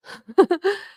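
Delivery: a sudden high burst of laughter. Real, not acted. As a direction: laugh out loud at the joke. Laughs at her own joke.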